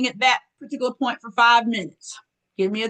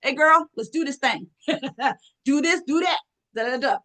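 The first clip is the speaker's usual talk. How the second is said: her own cheerleader voice; 3 semitones higher than usual. Retold or imitated. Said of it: "That's how I talk".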